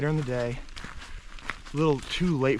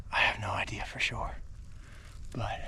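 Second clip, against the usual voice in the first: whisper; 2 semitones lower, 8 dB quieter than usual. Real, not acted. Hiding from a truck.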